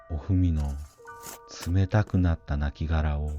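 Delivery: usual speaking voice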